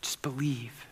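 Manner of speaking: breathy hushed whisper; soft whispered plea. Real, not acted. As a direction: lean in and barely breathe it. Quiet closing plea.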